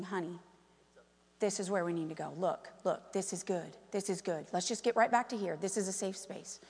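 soft, low coaxing voice; quietly steer your husband back on track; tender, hushed encouragement to a spouse; gentle reassurance. Retold or imitated. Wife gently steering her weak husband.

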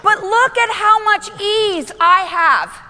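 loud, high, drawn-out preaching; big, slow, emphatic sermon delivery; full-force preaching. Acted. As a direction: preach it out to the whole room.

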